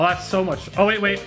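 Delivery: ordinary delivery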